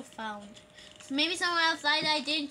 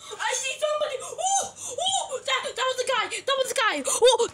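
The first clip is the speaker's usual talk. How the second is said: high, loud, shrieky alarm; panicked excitement; 9 semitones higher, 6 dB louder than usual. Real, not acted. Player suddenly spotting somebody in the game, shrieking. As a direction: shriek it out in a startled rush.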